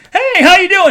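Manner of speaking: high, loud sing-song greeting; bright pushy waitress sing-song; chirpy server voice. Retold or imitated. Server greeting customers at the counter, high sing-song. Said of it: "another guy comes in".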